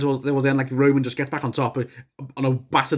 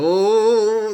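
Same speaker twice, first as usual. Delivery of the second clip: high, startled oh; gasp of surprise; 6 semitones higher, 4 dB louder than usual. Retold or imitated. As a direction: gasp as if the blow is coming. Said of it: "I was like".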